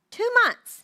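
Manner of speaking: high punched Two months; emphasis. Real, not acted. Speaker stressing Two months to the audience, high and loud.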